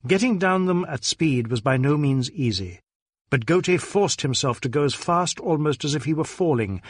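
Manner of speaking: plain reading voice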